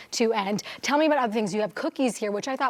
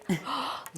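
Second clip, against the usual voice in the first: sharp delighted intake of breath; surprised gasp; 5 dB quieter than usual. Real, not acted. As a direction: gasp at the sight of it. First look at the pastry.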